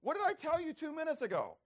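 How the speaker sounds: high, loud scolding; acted burst of parental impatience; exasperated parent. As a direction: snap at a child who asked twice. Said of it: "that's Bob in the flesh".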